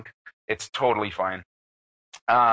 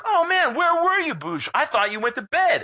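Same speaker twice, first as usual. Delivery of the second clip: put-on much higher, hyped drunken welcome; excited greeting; 13 semitones higher than usual. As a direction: squeal it like old friends reuniting. Friends greet him late at night.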